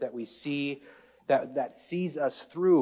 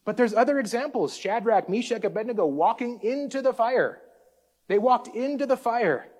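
full-force preaching; 7 semitones higher than usual. Acted. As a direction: build the sermon to a call-and-response peak.

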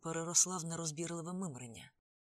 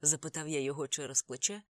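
plain reading voice

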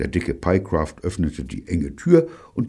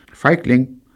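high, loud indignant jab; 9 semitones higher, 8 dB louder than usual. A narrator's reading. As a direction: throw out the insult indignantly. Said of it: "murmelte Evelyn empört". (empört, hoch und laut)

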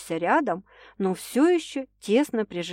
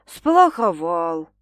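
sheepish admission; 6 dB louder, much slower than usual. A narrator's reading. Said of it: "кисло признался Стас с глубоким вздохом".

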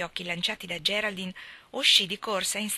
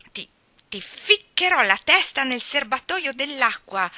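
loud, raised, stammering threat; exasperated yell; 6 semitones higher, 7 dB louder than usual. A narrator's reading. Gerald threatens to dunk Ivy, exasperated yell. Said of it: "urlò Gerald esasperato".

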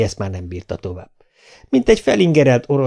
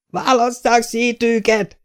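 high, slow, urgent shout; councillors shouting; 13 semitones higher, slower than usual. A narrator's reading. Councillors shout to separate the brawling men, urgent high shout. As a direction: yell to break up the fight. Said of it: "kiabáltak a képviselők".

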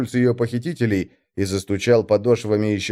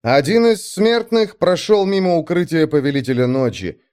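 ordinary delivery

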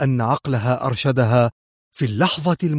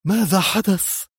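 higher anxious "ماذا حدث"; deeply worried question; 7 semitones higher than usual. A narrator's reading. Zosha asking her leader what happened, anxious. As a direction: ask with alarm in the voice. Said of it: "فسألته زوشا بقلق عارم".

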